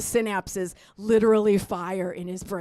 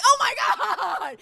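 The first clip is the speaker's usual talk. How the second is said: mock panicked wail; hammed-up freak-out; 11 semitones higher, faster than usual. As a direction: stretch it into a wobbling cry. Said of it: "I'm freaking out".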